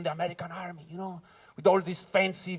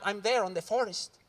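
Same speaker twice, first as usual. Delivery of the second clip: ordinary talk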